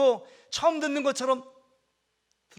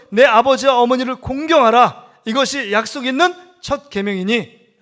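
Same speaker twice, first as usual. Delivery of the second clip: loud verse reading; 8 dB louder than usual. Acted. Preacher reads verse forcefully.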